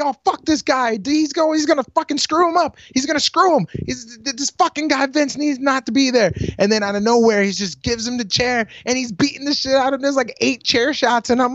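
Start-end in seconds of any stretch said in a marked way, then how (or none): 0.0-6.3 high, stumbling indignant rant; retold agitated protest. Fan watching the villain interfere, agitated. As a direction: relive yelling at the TV.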